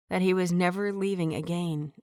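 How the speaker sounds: plain reading voice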